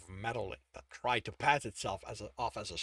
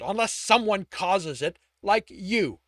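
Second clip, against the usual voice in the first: snarled; 6 semitones higher, 8 dB louder than usual. A narrator's reading. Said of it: "Tibbs snarled".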